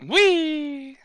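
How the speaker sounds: excited whee. Real, not acted. Celebrating a lucky dice roll.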